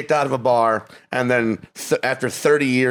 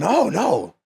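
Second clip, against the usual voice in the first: high-pitched earnest reassurance; mock earnest; 8 semitones higher than usual. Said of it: "Like, being earnest".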